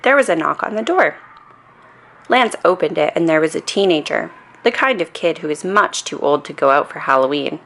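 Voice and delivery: ordinary delivery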